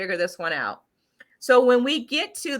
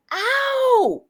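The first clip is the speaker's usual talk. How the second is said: sharp high yelp of pain, much higher; sudden pain cry; 4 dB louder than usual. Retold or imitated. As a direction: cry out as if stabbed. Acts out sudden piercing pain.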